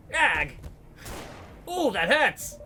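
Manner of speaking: high, loud yelp of pain; a gangster hurt in the struggle; pained cry. Acted.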